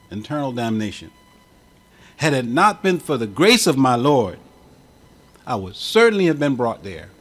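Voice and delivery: ordinary talk, nothing marked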